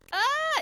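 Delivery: very high yelp imitating a wobbling old lady; mock startled cry. Old lady wobbles atop the church, high mock cry. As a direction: yelp high, as if losing your footing. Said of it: "I can see her losing her balance".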